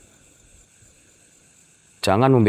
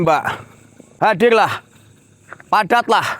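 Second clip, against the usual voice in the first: calling out to a spirit; 13 semitones higher than usual. Acted. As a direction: hail the unseen woman across the dark. Calling out to ghosts at night.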